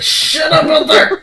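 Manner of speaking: shouted with a laugh; yelling. Real, not acted. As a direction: yell at someone outside. Yelling at noise outside.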